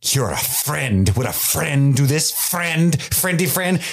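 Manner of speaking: rising high, sarcastic friend-denial; mock offended Durin. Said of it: "Durin would be like".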